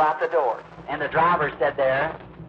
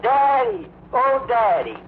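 high, loud wailing call for her daddy; lost blind girl wails high and loud for her daddy; pathetic cry; 9 semitones higher, 4 dB louder, much slower than usual. Retold or imitated. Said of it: "I heard somebody hollering".

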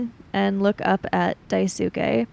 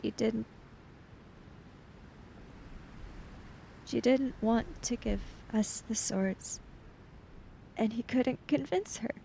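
quiet, hushed; 2 semitones higher, 11 dB quieter than usual. Acted. GM voices grieving Chiaki quietly.

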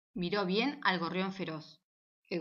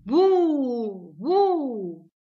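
very loud boo, hands up to scare; little mouse roaring boo, much louder; scary shout; 3 semitones higher, 12 dB louder than usual. Acted. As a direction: shout boo to frighten back. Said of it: "levantó las manos para asustar y gritó".

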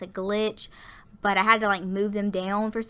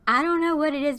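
real happy lift, pitched higher; excited; 6 semitones higher than usual. Real, not acted. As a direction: share good news with a raised voice. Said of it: "I am so excited".

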